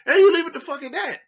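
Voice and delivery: fed-up high exclamation; emphatic outburst. Real, not acted. Ranting about players talking to media.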